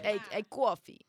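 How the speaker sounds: put-on light Brooklyn accent, coffee as kwoffee; a hint of Brooklyn street talk. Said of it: "can you speak in a Brooklyn accent".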